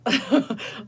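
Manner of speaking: high teary laugh; a high emotional laugh. Real, not acted. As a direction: laugh through the tears.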